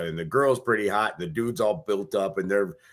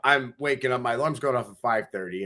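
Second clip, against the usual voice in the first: usual speaking voice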